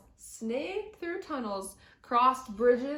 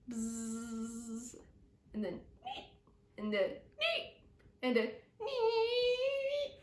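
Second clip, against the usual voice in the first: mosquito buzz; 5 dB quieter, slower than usual. A narrator's reading. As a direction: imitate mosquitoes whining. Mosquito sounds in the dark.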